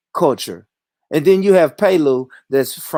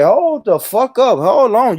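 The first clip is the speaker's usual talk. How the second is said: louder and higher, mocking outrage; indignant mock shout; 8 semitones higher, 5 dB louder than usual. Brothers objecting, mocked in a high indignant shout. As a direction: throw it back like an offended brother. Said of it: "they say".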